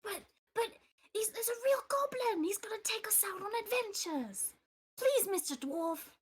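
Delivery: put-on high, whispered child's plea; pleading child. Boy begging the dwarf, hushed and high. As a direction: beg the dwarf in a hushed kid voice.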